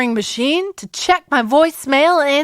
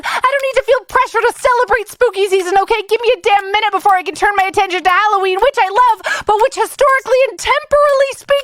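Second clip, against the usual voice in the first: angry voicemail; 8 semitones higher than usual. Acted. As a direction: build to a fast, high-pitched rant. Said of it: "a new segment I'm calling Angry Voicemail".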